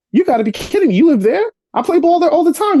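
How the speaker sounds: replaying his own thrilled shock at the coincidence; excited disbelief. Retold disbelief, same neighbourhood.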